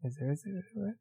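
softly repeating, like a broken recording; imitating bad audio. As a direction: echo the line fading out. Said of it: "repeating their lines".

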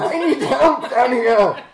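high, loud playful holler; mock cry for help. Player echoing the merchant's plea, mock-desperate shout. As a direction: call out in joking panic through laughter.

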